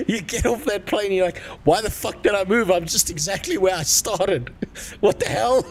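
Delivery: high-pitched mock outrage through laughter; laughing disbelief. Emigrant landing exactly where he started, laughing disbelief. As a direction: act out the baffled emigrant while cracking up. Imagines disappointed emigrant, cracking up.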